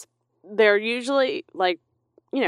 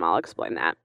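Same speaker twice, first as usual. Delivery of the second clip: ordinary delivery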